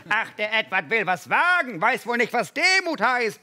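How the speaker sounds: mock yell of the scornful mosquitoes; mosquitoes bellowing. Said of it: "brüllten sie".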